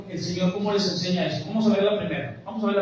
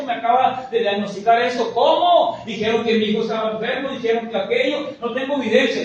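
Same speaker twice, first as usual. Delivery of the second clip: ordinary talk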